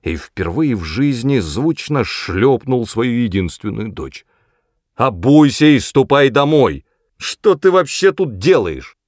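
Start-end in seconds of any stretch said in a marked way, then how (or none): 4.9-9.0 loud, higher-pitched telling-off; angry scolding. A narrator's reading. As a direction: order a child home crossly. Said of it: "гневно воскликнул мистер Трис". (гневно отчитывает, повысив голос)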